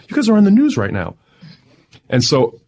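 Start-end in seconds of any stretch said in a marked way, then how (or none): 0.1-1.2 high and fast, breathless news; excited callers. Retold or imitated. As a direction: rush it out like a thrilled caller. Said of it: "just saying".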